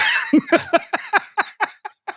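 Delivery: long, high-pitched burst of laughter. Real, not acted. Host laughing at co-host's drunken-night confession, high laughter. Reacting to friend's crying confession.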